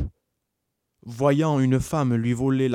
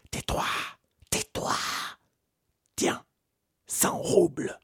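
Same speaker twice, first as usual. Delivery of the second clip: whispered bribe; hushed priest. A narrator's reading. Priest hushing the idiot.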